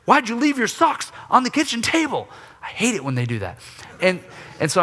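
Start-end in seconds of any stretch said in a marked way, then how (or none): 0.0-2.3 put-on griping at kids. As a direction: nag the kids sharply about the socks. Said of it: "I'm griping at the kids".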